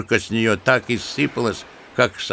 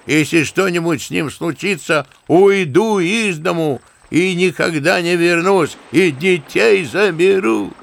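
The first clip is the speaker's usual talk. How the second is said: shouted after; 6 semitones higher than usual. Acted. Wife shouts to her husband as the train leaves, brazen call. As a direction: fling the words after him brazenly. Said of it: "крикнула".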